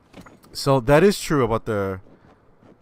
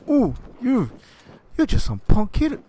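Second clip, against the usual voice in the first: much higher, louder sneering dad; scornful father; 13 semitones higher, 5 dB louder than usual. Retold or imitated. Father sneering at the prank caller, high sneering voice. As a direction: sneer it down the phone.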